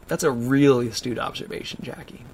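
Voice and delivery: usual speaking voice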